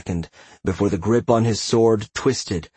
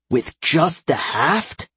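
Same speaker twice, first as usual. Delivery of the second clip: incredulous; 7 semitones higher, much slower than usual. A narrator's reading. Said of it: "he thought incredulously".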